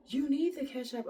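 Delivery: man telling the woman to keep up; impatient retort. Retold or imitated. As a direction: snap back at her impatiently. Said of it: "And he's like".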